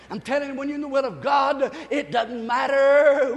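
building pulpit fervor, last word stretched loud; fired-up preaching. Real, not acted. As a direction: preach it rising toward a whoop.

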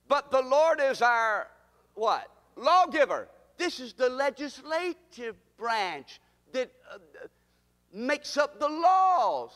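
rousing preacher leading the church; preaching at full force. Real, not acted. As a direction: proclaim each title and stretch the last word. Preaches the three branches.

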